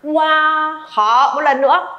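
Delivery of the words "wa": WA opening wide into a full, held high A; wide round A. Shown as a demonstration. Teacher modelling mouth shape, wide-open WA. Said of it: "Khẩu hình của mình mở rộng ra".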